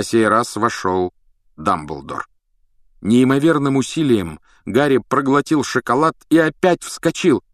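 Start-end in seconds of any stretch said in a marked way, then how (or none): none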